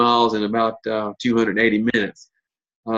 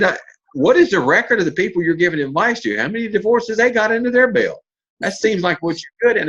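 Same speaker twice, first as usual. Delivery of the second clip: high, teasing incredulity; mocking; 8 semitones higher than usual. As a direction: needle her about her record. Teasing the marriage counselor.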